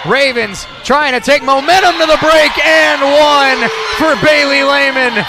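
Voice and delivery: loud, high-energy sports call; excited play-by-play. Real, not acted. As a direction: call the run at full voice.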